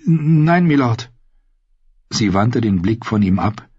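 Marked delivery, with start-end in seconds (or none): 0.1-1.1 slightly higher, faltering reply; a touch of nervous hesitation; hesitant stammer. A narrator's reading. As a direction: stumble timidly on the first word. Uneasy wife answers with a stammer.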